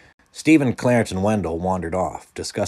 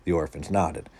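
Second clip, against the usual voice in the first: plain reading voice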